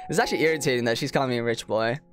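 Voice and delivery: ordinary talk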